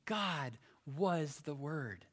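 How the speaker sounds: slow and weighty, God stressed; emphatic word order. Shown as a demonstration. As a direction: lean hard on God, slowly. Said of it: "It's emphasizing it".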